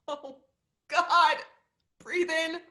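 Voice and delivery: loud and strained; panicked cry. Acted. Molly fighting the panic attack, loud. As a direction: force the words out in panic. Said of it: "breathing of a panic attack".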